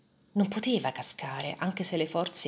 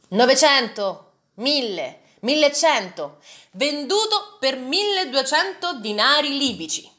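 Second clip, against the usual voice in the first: loud, high, rising auction calls; auctioneer calling bids; 8 semitones higher, 6 dB louder than usual. A narrator's reading. Merchant selling the young man, loud auctioneer calls. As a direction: hammer out the bids and close the sale.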